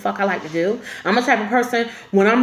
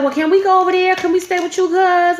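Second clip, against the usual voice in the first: pleading cousin; 7 semitones higher, 4 dB louder than usual. Retold or imitated. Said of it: "she was like".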